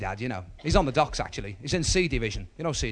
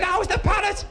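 very high, raised-voice question; shouting for deaf gran; 4 dB louder, slower than usual. Acted. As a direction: holler the question to someone hard of hearing. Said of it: "my gran was a bit Mutt and Jeff".

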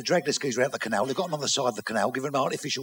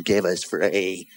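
low, very slow order; drawn-out request; 9 semitones lower, much slower than usual. Acted. Customer back at the chemist, asking slowly and low. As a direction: stretch out each word, pitched down.

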